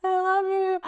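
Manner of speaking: high, overcome retold cry of love; imitated tearful love. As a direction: whimper it as if welling up. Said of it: "made me cry".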